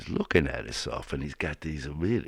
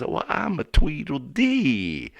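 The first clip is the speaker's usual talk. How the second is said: put-on higher, louder, slow boy voice; 11 semitones higher, 7 dB louder, slower than usual. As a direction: announce yourself like a proud kid. Other boy introduces himself.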